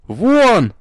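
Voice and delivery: high, loud screamed word; hysterical scream. A narrator's reading. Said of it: "прорвало маркизу на истеричный вопль".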